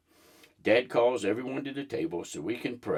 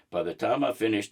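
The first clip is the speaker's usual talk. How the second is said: ordinary delivery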